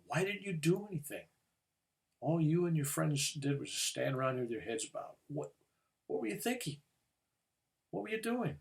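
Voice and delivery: loud, high, furious questioning; angry demand. Retold or imitated. Rescuer confronting the girl who did nothing, furious shout. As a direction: let the anger burst out at her. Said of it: "I'm just mad".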